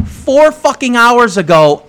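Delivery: high, slow angry emphasis; indignant outburst. Retold or imitated. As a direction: lay into the neighbour, drawing out each word. Retold angry confrontation with neighbour.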